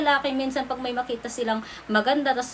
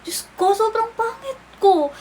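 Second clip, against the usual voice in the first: mock horror; 9 semitones higher, 4 dB louder than usual. Looking at old videos.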